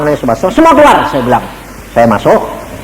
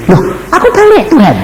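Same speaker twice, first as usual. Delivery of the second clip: put-on childlike protest.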